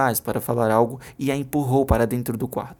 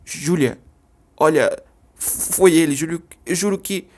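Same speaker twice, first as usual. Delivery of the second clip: panicked plea; 4 semitones higher, 4 dB louder, slower than usual. Acted. Travis pleads, panicked.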